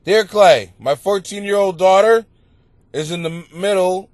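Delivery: loud reading of a listener letter. Acted. Host reading a listener's letter aloud on the show. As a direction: read the letter out clearly.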